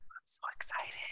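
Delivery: excited breathy whisper. Acted. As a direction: whisper it like a secret. Excited whisper after intro.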